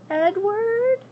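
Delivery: high, tender call; young girl. Acted. Abilene speaking to Edward, high and tender. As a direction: call him gently.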